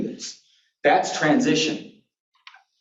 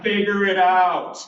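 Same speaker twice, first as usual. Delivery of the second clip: slow, higher, stretched-out order; drawn-out insistence; 6 semitones higher, slower than usual. Retold or imitated. Caregiver pushing brother-in-law to solve it himself, drawn-out insistence. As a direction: stretch each word to push him.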